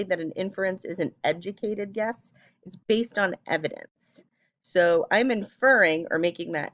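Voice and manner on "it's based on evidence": normal talking voice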